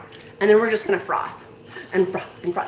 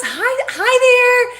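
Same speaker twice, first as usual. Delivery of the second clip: high, slow, sing-song hi; stretched excited hello; 7 dB louder, much slower than usual. Real, not acted. Welcoming the West Coast viewers, sing-song high hello. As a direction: draw the greeting out with glee.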